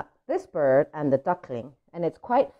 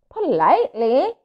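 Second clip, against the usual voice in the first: higher, louder prim little duckling; 7 semitones higher, 5 dB louder than usual. Acted. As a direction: correct the pigeon primly. Duckling answers pigeon.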